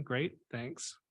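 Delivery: ordinary delivery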